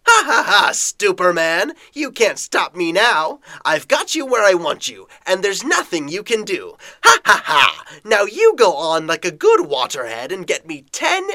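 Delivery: loud, cackling mad villain; gloating villain. Acted. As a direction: gloat and laugh over the hero.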